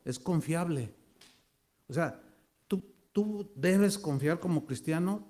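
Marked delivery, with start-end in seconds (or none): none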